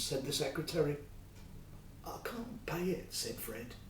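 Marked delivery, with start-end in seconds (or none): 2.0-3.1 breathless whisper of a ruined man; desperate whisper. Acted. Fred whispering to the king that he cannot pay. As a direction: whisper it, crushed and hopeless.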